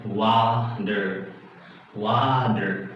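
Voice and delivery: "water" with the T as a soft D; flapped T. Shown as a demonstration. Teacher showing T between two vowels, flapped T in "water". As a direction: slowly say "water" as "wa-der". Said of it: "you can make it as a flat D sound".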